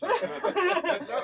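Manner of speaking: high, loud laughter through the words; giggling while brushing off the request for an accent; laughing. Real, not acted. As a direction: laugh it off, caught out.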